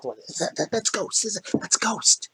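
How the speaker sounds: soft excited whisper. Acted. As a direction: whisper it to each other. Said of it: "They start whispering back and forth".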